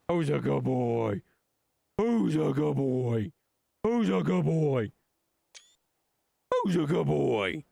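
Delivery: put-on baby talk to a dog. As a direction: coo it like talking to a puppy.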